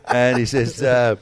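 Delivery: ordinary talk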